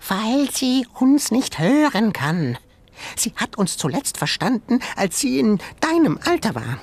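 high, quick little creature voice; high animal voice. Acted. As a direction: squeak it like a small museum animal. Animal voice in story.

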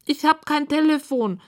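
high, plaintive pig voice; high piggy lament; sad pig. A narrator's reading. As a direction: whine it like a helpless little pig. Sad pig explains her problem.

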